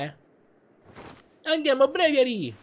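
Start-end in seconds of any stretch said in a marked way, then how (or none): 1.4-2.5 high, loud shout of the name; battle cry. Acted. Trainer shouting to send his Pokémon into battle, loud battle cry. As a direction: call the Pokémon out with full force.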